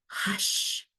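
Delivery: soft unvoiced whisper to the cubs; a mother bear hushing her cubs in a whisper. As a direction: breathe it out as a whisper, no voice. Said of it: "whispered Mama Bear".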